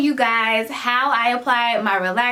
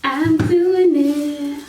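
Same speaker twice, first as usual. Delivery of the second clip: slow, higher, drawn-out declaration; nervous excitement; 6 semitones higher, 3 dB louder, much slower than usual. Real, not acted. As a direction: psych yourself up. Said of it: "I was so nervous".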